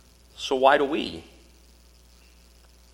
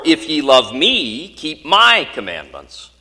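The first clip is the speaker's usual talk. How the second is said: "me" and "my" stretched and louder; stress on ME and MY; 2 semitones higher, 5 dB louder than usual. Shown as a demonstration. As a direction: lean hard on "me", then on "my", slowly. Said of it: "emphasizing the words in different fashions".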